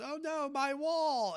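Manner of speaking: high, slow, wailing imitation of a child; devastated daughter. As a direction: mimic the crushed daughter, stretched out and high up. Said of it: "and was, like, almost devastated".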